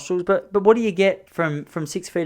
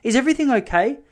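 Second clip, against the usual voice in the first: higher, louder, gasping with worry; alarmed wife; 8 semitones higher, 4 dB louder than usual. Retold or imitated. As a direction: play the panicked wife hearing "hospital". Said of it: "She goes".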